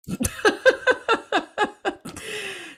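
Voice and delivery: long, high-pitched burst of laughter; real laugh. Real, not acted. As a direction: laugh out loud in a high, rolling run. Laughs after showing her grandparents' photo.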